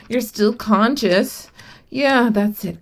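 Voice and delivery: ordinary delivery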